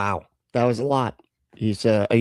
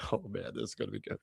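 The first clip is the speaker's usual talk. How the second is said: mock scheming mutter; 2 semitones lower, 15 dB quieter, much faster than usual. Imitating staffer.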